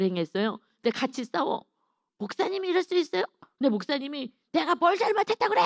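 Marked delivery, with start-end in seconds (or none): 2.2-3.2 high, offended cry of a wronged churchgoer; indignant protest. Retold or imitated. Churchgoer protesting to the pastor, high indignant mock cry. 4.5-5.6 high, louder mock-angry comeback; defensive retort. Pastor shouting back at the churchgoer, high mock-angry retort.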